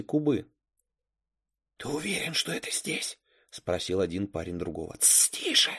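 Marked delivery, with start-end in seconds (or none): 1.8-3.2 put-on hushed, softer and higher boy's voice; hushed question. A narrator's reading. One masked boy secretly asks the other outside the window, hushed. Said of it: "прошептал второй, хотя сам говорил громче". 5.0-5.7 urgent whispered shushing. A narrator's reading. Second boy hushes his partner outside the window, whispered. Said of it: "прошептал второй".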